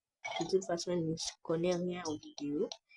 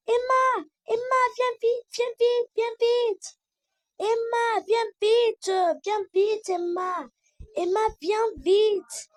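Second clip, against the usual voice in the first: high, loud, urgent calls; play-acted kitchen alarm, shouted high; panicked shouting; 13 semitones higher, 7 dB louder than usual. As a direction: call Emma over as if the pan is burning. Acted panic over burning pasta.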